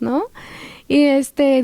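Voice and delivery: ordinary talk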